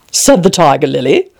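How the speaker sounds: plain reading voice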